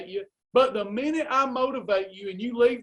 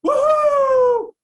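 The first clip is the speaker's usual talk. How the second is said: a much higher, much louder whooping woohoo; mock cheer; 14 semitones higher, 10 dB louder than usual. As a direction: whoop like a partygoer, over the top.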